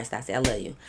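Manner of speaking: ordinary talk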